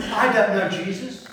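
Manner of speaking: acted protest of a disowning friend; Peter's denial. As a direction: insist loudly, disowning him. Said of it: "your right-hand man Peter has betrayed you".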